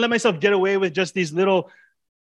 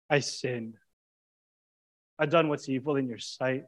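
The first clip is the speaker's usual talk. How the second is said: hushed confession; 3 semitones lower, 6 dB quieter than usual. Acted. Voicing confession to the Lord.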